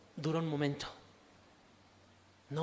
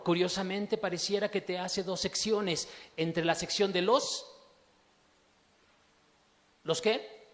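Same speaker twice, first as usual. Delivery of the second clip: ordinary talk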